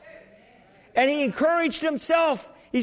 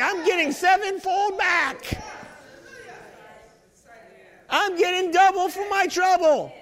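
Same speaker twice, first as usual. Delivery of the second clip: excited declaration; 8 semitones higher than usual. Shown as a demonstration. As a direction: proclaim it high, rousing the congregation. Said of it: "you need to start getting excited".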